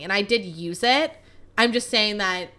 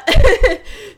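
loud burst of laughter; 12 semitones higher, 11 dB louder than usual. Real, not acted. Laughing at her own story.

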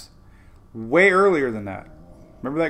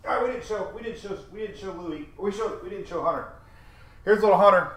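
higher, cooing voice for the puppy; puppy talk; 6 semitones higher than usual. Real, not acted. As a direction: coo at the little dog. Showing the puppy on camera.

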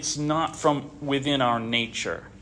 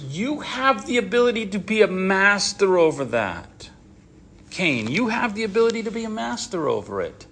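higher-pitched, lightly acted urging; earnest exhortation; 5 semitones higher than usual. God telling Cain he can master sin, earnest exhortation. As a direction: plead the point with drawn, sustained emphasis.

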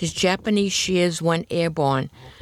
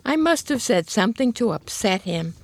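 lightly voiced apologetic whimper, pitched up; mild whimper; 7 semitones higher than usual. A narrator's reading. Apologetic mother explaining the dog bite, mild high whimper. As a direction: narrate a gently whimpering mother. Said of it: "she whimpered".

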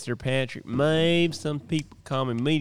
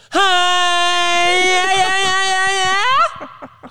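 very high, loud mock scream; imitated "oh yeah" holler; 8 dB louder, much slower than usual. Retelling a friend's answer to "oh yeah", mock holler. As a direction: wail it out in one long hammed-up run. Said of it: "Can I get an oh yeah".

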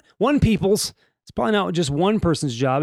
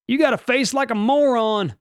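high-pitched hammed-up Southern cop; mock Southern cop, pitched up; botched Southern accent; 8 semitones higher than usual. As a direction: insult them in a bad Southern accent. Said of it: "I turned it into a Southern accent".

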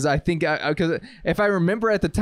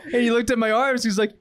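usual speaking voice

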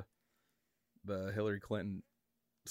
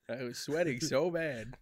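silly put-on voice; 3 semitones higher, slower than usual. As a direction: ham up the sweaty memory, laughing. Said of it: "I gave you that fucking voice".

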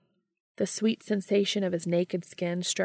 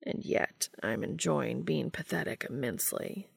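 soft, lower murmur; 4 semitones lower, 6 dB quieter than usual. Acted. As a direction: murmur it contentedly in bed. Castiel murmurs in bed.

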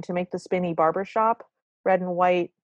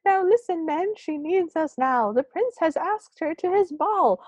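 put-on very high toddler giving orders; toddler's voice; 10 semitones higher than usual. Gnome calling his men to listen, toddler's voice. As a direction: address the troops in a tiny child's voice. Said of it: "It's a toddler's voice".

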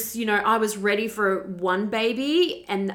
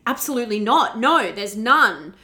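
louder, higher and firm, with the second line slowed; half yell; 4 semitones higher, 8 dB louder than usual. Retold or imitated. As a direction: deny it flat out. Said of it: "almost yelling at her saying".

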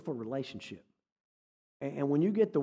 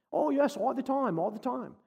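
put-on British accent; 7 semitones higher than usual. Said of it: "And he had an accent".

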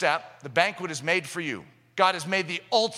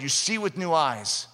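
usual speaking voice

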